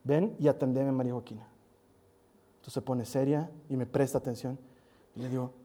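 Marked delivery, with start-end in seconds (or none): 0.0-1.5 lower, a hint of a stern father teasing; mock stern father. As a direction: pretend to be serious, lightly. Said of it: "le digo con cara de enojado". (padre falsamente serio, en broma)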